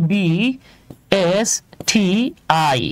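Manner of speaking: B S T I said slowly as separate letters with pauses between; spelled letter by letter. Shown as a demonstration. Teacher showing students an abbreviation, each letter spoken on its own. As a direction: read B S T I out one letter at a time, unhurried. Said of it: "এটা উচ্চারণ করতে হয় B S T I".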